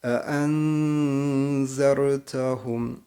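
E enzertahum with the n hidden through the nose, very slow; slow chant, nasalized n in enzertahum; nasal ihfa. Shown as a demonstration. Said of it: "Nun harfini genizden getiriyoruz".

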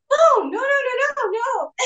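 high-pitched, flustered protest, retold; mock-panicked denial, leaping up high. Acting out flustered denial to waiter.

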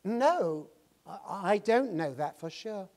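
lightly voiced foreign accent, slow; a hint of an English accent, unsure; obvious accent. Young man answering the preacher in a mild English accent. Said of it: "noticed this obvious accent".

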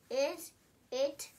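usual speaking voice

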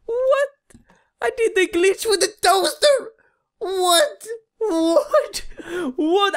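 high, astonished cries; high stunned yelling; amazed disbelief. Real, not acted. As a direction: gasp in disbelief at the glitch. Amazed at toaster glitch.

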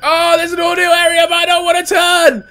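high, loud frantic pleading. Real, not acted. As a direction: beg the game in panic. Pleading during game.

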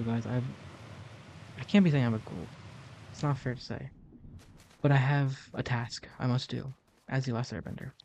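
ordinary talk, nothing marked

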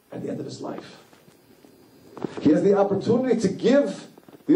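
high, fervent sermon voice with lingering words; impassioned preaching. Acted. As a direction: preach the martyrdom with swelling passion. Preacher tells of Akiva's martyrdom.

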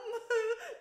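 high, loud fake sob; huge pretend sob. A narrator's reading. Spoilt boy faking tears, loud sobbing. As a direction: bawl theatrically without words. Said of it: "huge pretend sobs".

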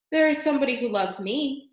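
lightly voiced devoted Despereaux; earnest young mouse. A narrator's reading.

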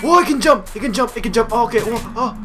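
high, repeated exclamations; startled alarm. Real, not acted. Surprised that enemy can jump.

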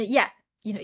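ordinary talk, nothing marked

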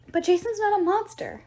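high-pitched, urgent protest; insistent. A narrator's reading. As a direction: push back hard, voice lifted. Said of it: "Piper insisted".

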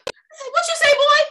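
high, shocked what you say; startled. Retold or imitated. Woman startled by little boy, high and shocked. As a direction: gasp it out, high. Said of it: "I said".

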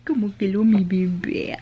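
slow tender whisper. Real, not acted. Mother whispering to her freshly changed baby.

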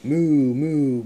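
mooing cow. Acted. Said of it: "let's review".

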